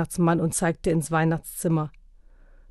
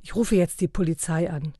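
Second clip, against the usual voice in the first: plain reading voice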